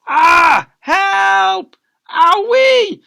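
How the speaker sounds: high, loud squealing yelps of pain; hammed-up screams of little victims; panicked kids' cries. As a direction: shriek like a child being run over. Kids run over by scooter.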